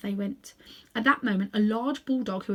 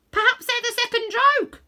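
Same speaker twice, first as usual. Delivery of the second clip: much higher, chattering bird; a bird's cheeky suggestion; high jackdaw voice; 8 dB louder than usual. Acted. Jackdaw speaks.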